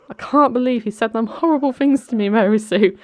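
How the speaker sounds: high, whiny mock upset; mock crying. Brandon complaining to Mary Sue, mock tearful. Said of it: "like, crying. He's like".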